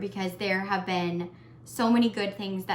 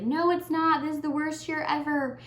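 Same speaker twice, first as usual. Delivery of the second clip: higher, exasperated imitation of everyone; mock dismissive complaint; 7 semitones higher than usual. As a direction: scoff at the year in a pitched-up mock voice. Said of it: "everybody's like".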